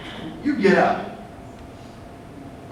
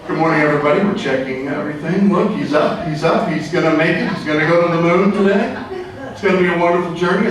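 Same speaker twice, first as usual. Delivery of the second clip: mission control; 6 semitones lower than usual. Pretend. Mock mission control greeting everybody on launch day, low announcer voice. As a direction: lower announcer voice, calm and steady.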